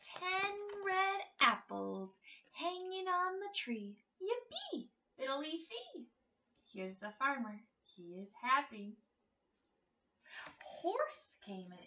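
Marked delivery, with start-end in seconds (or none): 4.2-6.1 gleeful refrain. Acted. Refrain shouted with glee.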